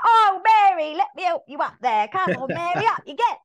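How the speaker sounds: high, loud mock sweeps rallying round; calling out helpfully. Sweeps helping fallen Mary up, calling out loudly. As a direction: holler encouragement as the chirpy helpers.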